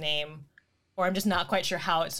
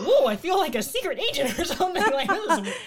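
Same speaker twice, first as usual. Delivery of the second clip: excited; 8 semitones higher, 6 dB louder than usual. Retold or imitated. Retold amused reaction to emails.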